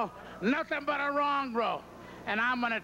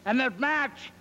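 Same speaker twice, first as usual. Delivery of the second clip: louder, pumped-up trash talk; fired-up wrestling promo; 2 semitones higher, 4 dB louder than usual. Acted. Wrestler calling out Piper before the match, loud and pumped. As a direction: hype the crowd and taunt the rival.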